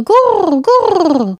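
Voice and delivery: high cooing pigeon call. Acted. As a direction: coo it like a wood pigeon. Bird coos in fairy tale.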